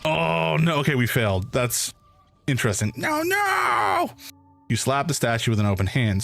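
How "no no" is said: high panicked squeak; the tiny ant panics; panicked high shout. Acted.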